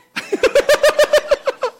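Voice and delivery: a high, loud burst of delighted laughter; high ringing laugh of delight; joyful laugh. Real, not acted. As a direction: let the joy spill out in a big laugh. Joyful laugh after healing story.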